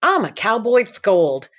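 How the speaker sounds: put-on high lisping kid voice; lispy boy voice. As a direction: lisp it as a goofy boy. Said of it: "came the lispy reply".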